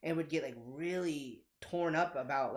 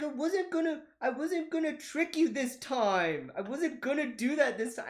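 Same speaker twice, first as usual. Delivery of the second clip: high, drawn-out mock whine; whiny crying kid; 9 semitones higher, 3 dB louder, slower than usual. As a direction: plead like a wronged child. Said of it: "Why are you crying?".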